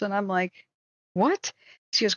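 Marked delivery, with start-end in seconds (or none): 1.1-1.5 higher shocked what; retold disbelief. Reading a review about mothball candy dishes, retold shocked what. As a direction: react like you can't believe it.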